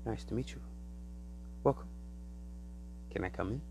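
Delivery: soft, polite alien at the door; quiet alien visitor. Retold or imitated. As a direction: ask to come in gently. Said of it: "and they say".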